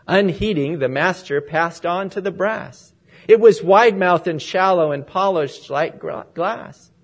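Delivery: measured, lilting poem reading with rising emphasis; heartfelt church poem read aloud; poem recitation. Acted. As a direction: read the verse like a preacher sharing a treasured poem.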